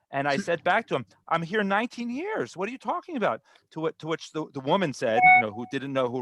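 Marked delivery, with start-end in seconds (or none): 1.3-3.4 high-pitched mock protest; retold indignant comeback. Immigrant answers the vendor calling him new, indignant retort. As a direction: push back, as if affronted.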